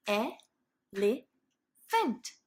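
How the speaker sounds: elephant said very slowly, each chunk drawn out; split into syllables. Shown as a demonstration. Teacher reading Dear Zoo aloud and chunking the word. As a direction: drag out elephant one syllable at a time. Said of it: "this is how I would play the game".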